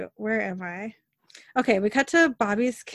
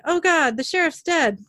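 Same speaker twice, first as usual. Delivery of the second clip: higher, louder cry of alarm; mock yell; 8 semitones higher, 5 dB louder than usual. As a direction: read it as a yelled alarm, only moderately loud. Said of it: "A man yells from outside".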